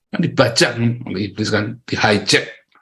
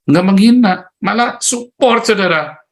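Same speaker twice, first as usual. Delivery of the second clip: excited; 8 semitones higher, 4 dB louder than usual. Real, not acted. Preacher exclaims about successful people.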